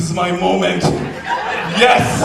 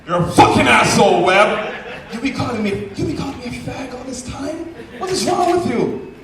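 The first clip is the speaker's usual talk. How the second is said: angry confrontation; 7 semitones higher than usual. Retold or imitated. Furious friend bursts through the door at him, angry rant. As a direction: storm in and accuse him.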